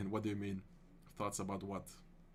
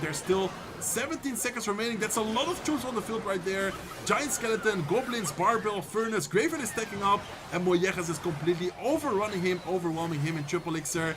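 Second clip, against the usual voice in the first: loud, higher, excited caster call of the final seconds; live play-by-play; 5 semitones higher, 5 dB louder than usual. Acted. Caster calling the last seconds of the match to stream viewers, loud and excited. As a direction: call the battle like a hyped esports caster.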